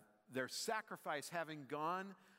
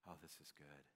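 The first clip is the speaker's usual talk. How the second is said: very low, soft murmur of relief; hushed, blissful self-talk; relaxed contentment; 10 semitones lower, 16 dB quieter, faster than usual. Retold or imitated. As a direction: settle in and murmur it, low and quiet. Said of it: "and I just went".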